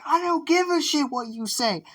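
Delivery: very high, loud hammed-up retort; defiant yell. Curse defying his teacher, high comic shouting. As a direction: holler it back on one high pitch.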